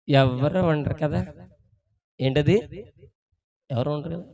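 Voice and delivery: low, hushed aside; furtive secret talk. Acted. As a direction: check nobody is around, voice dropped. Acts out furtive secret chat.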